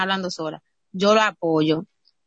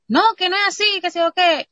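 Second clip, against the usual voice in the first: high, raised mock-protest voice; arguing boyfriend; 10 semitones higher, 3 dB louder than usual. Boyfriend answering his partner's scolding, high mock protest. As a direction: imitate a boyfriend answering back, pitched way up. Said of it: "el muchacho le explica".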